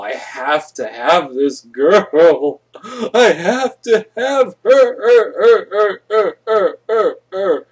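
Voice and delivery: rising into a high, loud, strained chant of her; obsessive craving. Retold or imitated. Obsessed lovestruck outburst.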